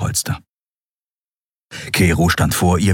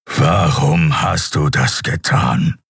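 slow, weighty creature voice; cooling anger; 2 semitones lower, slower than usual. A narrator's reading. Keru confronting Andriel over her act, cooling anger. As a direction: demand an answer, drawing the words out. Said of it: "Langsam schien sein Zorn abzukühlen".

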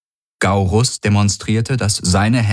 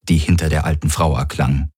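plain reading voice

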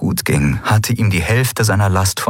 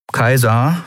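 usual speaking voice

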